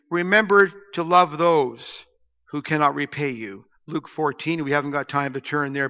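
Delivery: ordinary talk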